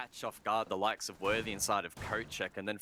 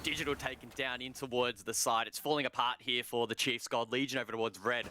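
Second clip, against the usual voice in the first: esports caster; 4 semitones higher than usual. Acted. Live round play-by-play.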